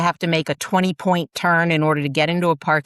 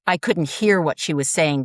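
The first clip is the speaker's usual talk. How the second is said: ordinary talk, nothing marked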